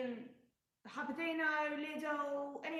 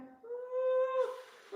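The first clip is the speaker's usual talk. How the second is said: high anxious ooh; 12 semitones higher than usual. Retold or imitated. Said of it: "And I'm going".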